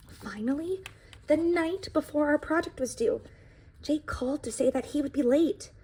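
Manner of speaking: storybook read-aloud voice; reading to children. A narrator's reading. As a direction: tell the pie's story to a classroom. Reads the book aloud.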